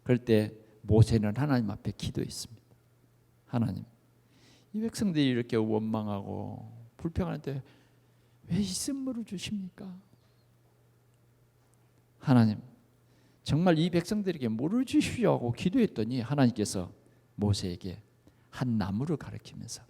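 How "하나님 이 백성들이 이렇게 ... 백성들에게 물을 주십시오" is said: soft, low pleading prayer; hushed prayer. Acted. Moses praying to God over the bitter water, soft and low.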